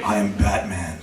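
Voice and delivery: low Batman voice. Acted. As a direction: drop low into a superhero voice. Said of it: "he's always like".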